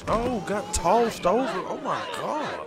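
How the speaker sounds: excited. Real, not acted. Reacting to wrestling throw.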